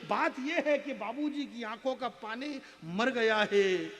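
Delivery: high, loud stage verse; impassioned declamation. Acted. Poet lamenting the father's grief to the crowd, impassioned declamation. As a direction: declaim it over the applause, stressing key words.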